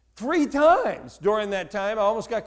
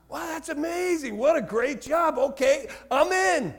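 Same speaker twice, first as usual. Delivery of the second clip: pitched up high, eager and gushing; hammed-up wide-eyed enthusiasm; mock naive delight; 7 semitones higher than usual. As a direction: play the gullible kid jumping at the job. Naive kid accepting the job.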